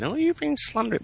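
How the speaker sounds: mock high-pitched lawyer; squeaky caricature of an objecting lawyer; complaining lawyer. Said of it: "some lawyer ringing me up saying".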